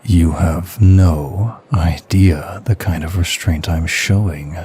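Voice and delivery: heated barely held-back lust; intense seductive. Acted. Barely restrained lust, heated confession.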